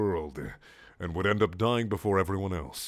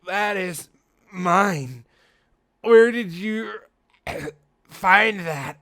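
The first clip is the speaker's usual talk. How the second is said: higher, frail put-on girl voice; sick Amy voice; 9 semitones higher, 7 dB louder, much slower than usual. Weak Amy voice with coughs.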